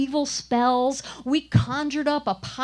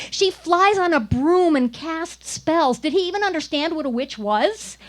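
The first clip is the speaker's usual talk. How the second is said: indignant rant; 4 semitones higher, 5 dB louder than usual. Retold or imitated. Mocking the director's casting reason.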